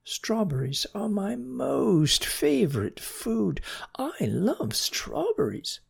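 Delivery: high, giddy snail gushing; prattling, excited. Acted. Snail gushes to the slug about strawberries, prattling. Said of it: "prattled Mona".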